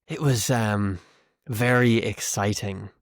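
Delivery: deadpan irony, dragging out VERY; sarcastic. Shown as a demonstration. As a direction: say it as if you found it very boring. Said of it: "if I'm being sarcastic".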